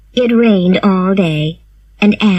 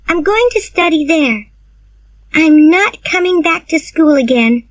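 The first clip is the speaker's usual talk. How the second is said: put-on girlish voice pitched well up, earnest; high schoolgirl declaring she won't go back; young Anne voice; 8 semitones higher than usual. A narrator's reading. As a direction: read Anne as a high young girl, firm and resolved. Anne's voice in read story.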